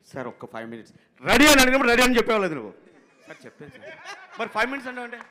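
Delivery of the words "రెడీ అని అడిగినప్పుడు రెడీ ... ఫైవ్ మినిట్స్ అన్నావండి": high, loud, fast scolding; impatient director impression. Director scolding the assistant over five minutes, loud and fast. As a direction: tell him off fast and sharp. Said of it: "director గారు".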